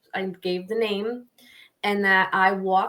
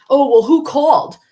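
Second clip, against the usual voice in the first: loud, higher retold challenge; indignant demand; 6 semitones higher, 11 dB louder than usual. Neighbor demanding to know who phoned parking enforcement, indignant and loud. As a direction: demand it loudly, acting out the neighbor.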